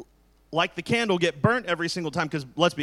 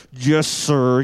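grudging yes sir; 5 semitones lower, 8 dB louder than usual. Retold or imitated. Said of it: "not like they're excited about doing it".

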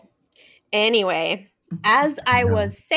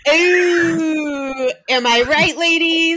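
higher, louder cheeky hollered catcall; mock catcall; 6 semitones higher, 6 dB louder, slower than usual. Joking about catcalls, hollering a hammed-up catcall.

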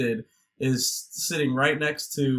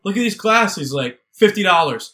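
loud, higher-pitched huckster pitch; eager salesman; 5 semitones higher, 8 dB louder, faster than usual. Retold or imitated. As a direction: hawk it like a pushy seller. Acting out Sam hawking glasses.

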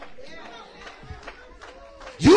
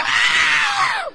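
mock preacher holler. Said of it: "start hollering".

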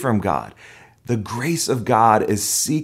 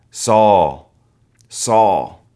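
calling voice of Jesus; 7 dB louder than usual. Acted.

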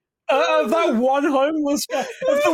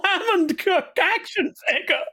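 put-on whiny child voice; 8 semitones higher than usual. Acting as an upset child.

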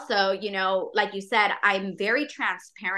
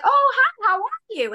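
imitating a cheery friend, pitched way up; mock chirpy greeting; 13 semitones higher than usual. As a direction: play the overly perky acquaintance. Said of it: "especially if they're like".

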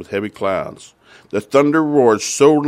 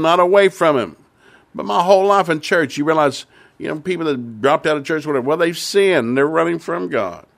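higher, pressing pulpit voice; preacher warming up, urgent; fervent preaching; 4 semitones higher than usual. Acted. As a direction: drive the point home to the congregation. Preaching at rising force.

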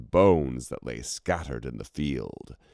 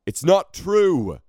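much higher and louder outcry; booming denial; 14 semitones higher, 7 dB louder than usual. A narrator's reading. The king protesting to the crowd, booming. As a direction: boom it over the crowd. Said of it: "boomed the king".

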